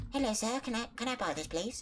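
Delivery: high, small sweet voice asking to buy; timid high voice. Retold or imitated. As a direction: sweetly ask like a shy kid. He quotes himself meekly asking.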